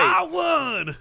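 whooping victory shout; yeehaw. Acted. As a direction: holler it like a rodeo cowboy. Race winner celebrating in studio.

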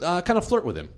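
normal talking voice

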